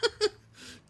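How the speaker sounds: real giggles through the words, loud and high; laughing. Real, not acted. The reader laughs at the imps shot in the butt, laughing. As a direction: laugh while telling it.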